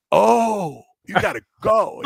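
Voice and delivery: high, retold startled realization; dawning realization. Developer realizing what the shaking player means, retold surprise. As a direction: let the meaning suddenly sink in. Retelling sudden realization of what's meant.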